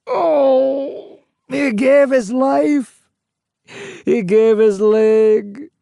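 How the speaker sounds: high, slow wailing lament; anguished crying. A narrator's reading. Ghost lamenting his son, crying. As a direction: cry out in grief. Grieving ghost recalls son's death.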